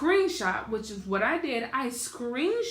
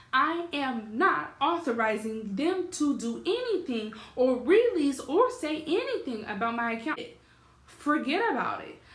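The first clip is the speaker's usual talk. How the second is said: higher, emphatic retold refusal; reenacted heated refusal, firm and raised; pissed off; 4 semitones higher than usual. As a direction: lay down the law, hitting each word. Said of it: "pissed off".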